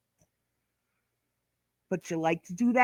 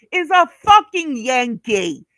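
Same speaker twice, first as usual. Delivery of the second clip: angry; 3 semitones higher, 7 dB louder than usual. Real, not acted. Angry rant at rival.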